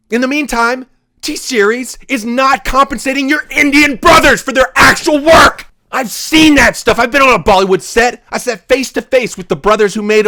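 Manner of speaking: real anger at unpaid workers; heated rant. Real, not acted. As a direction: rant hard at the injustice. Heated rant about T-Series.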